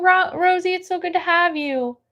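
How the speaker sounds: ordinary talk, nothing marked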